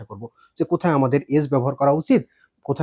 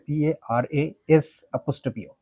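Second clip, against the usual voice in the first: spelling it out; much slower than usual. Shown as a demonstration. Teacher dictating the correct spelling slowly. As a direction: slowly spell out "পিএআরএএস apostrophe" letter by letter. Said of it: "যদি সঠিক করে লিখতে".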